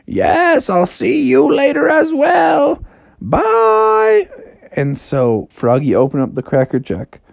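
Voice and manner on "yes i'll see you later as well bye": high, slow sing-out farewell; talking house. Acted. House says goodbye to Froggy, high and drawn-out. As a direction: draw the goodbye out grandly, very high. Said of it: "the house is like".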